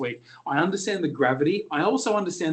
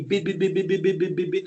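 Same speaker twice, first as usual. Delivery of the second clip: auction patter; 3 semitones higher than usual. Shown as a demonstration. Demonstrating rapid auction calling.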